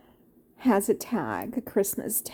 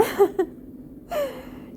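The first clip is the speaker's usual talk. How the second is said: high breathy laugh through tears; laughing off the tears, real; tearful laugh. Real, not acted. Tearful laugh while choked up.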